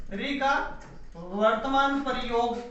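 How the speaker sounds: slow, even dictation pace for notes; धीमे, लिखवाने वाले अंदाज़ में. Shown as a demonstration. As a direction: spell out री का वर्तमान प्रयोग slowly so students can write it.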